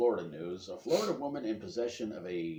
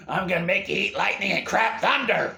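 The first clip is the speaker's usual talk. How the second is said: high, loud trainer impression; hollering old boxing trainer; 9 semitones higher, 12 dB louder than usual. Trainer Mickey firing up Rocky, hammed-up holler. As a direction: bellow it like a fight coach.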